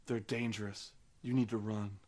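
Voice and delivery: low, soft spectral voice; ghostly warning. Retold or imitated. Ghost of Edward warning Bella, low and soft. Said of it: "this ghost goes".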